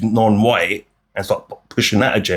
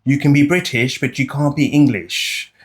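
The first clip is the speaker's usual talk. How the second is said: higher and slow, mimicking an elderly caller; slow, higher impression of an old woman caller; 5 semitones higher, slower than usual. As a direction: imitate the old lady phoning in, slow and prim. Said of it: "some old woman rang up and said that".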